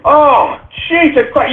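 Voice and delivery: ordinary talk, nothing marked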